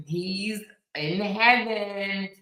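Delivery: normal talking voice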